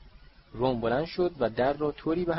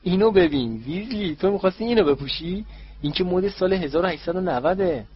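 higher, louder taunting mockery; mocking sneer; 8 semitones higher, 7 dB louder than usual. A narrator's reading. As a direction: jeer at his robe, pitched up high. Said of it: "مالفوی با لحن مسخره". (با لحن مسخره و صدای زیرتر و بلندتر)

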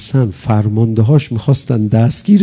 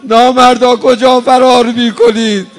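higher, louder, full-throated accusing cry; furious outcry; 10 semitones higher, 7 dB louder than usual. Retold or imitated. Hussein shouting after the fleeing cowards, furious.

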